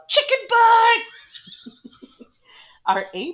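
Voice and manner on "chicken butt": put-on much higher, loud kid's yell. Older student mooning the class, yelling. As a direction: shout it like a cheeky kid. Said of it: "and yelled".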